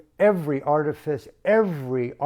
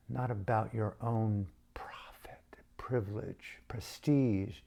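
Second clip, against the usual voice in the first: whispered plea; 4 semitones lower, 10 dB quieter than usual. Acted. Voicing her plea to dictator.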